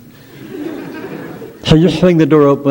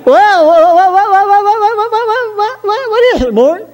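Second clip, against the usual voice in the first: put-on much higher, gushing falsetto girl; sweet melodious voice. Mabel gushes in a high falsetto to her sweetheart Mort. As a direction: coo at him like a swooning girlfriend. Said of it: "And you say".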